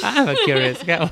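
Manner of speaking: mocking repeat.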